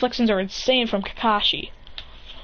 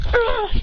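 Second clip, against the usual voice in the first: high, drawn-out mock scream; 12 semitones higher than usual. Bullies sent flying by the kick, high mock scream. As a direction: scream high without real volume. Knocked-back crowd cries out.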